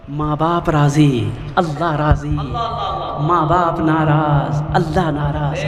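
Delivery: low, slow solemn recitation; weighty, measured preacher's pronouncement; solemn maxim. Real, not acted. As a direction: drop low and draw out each phrase.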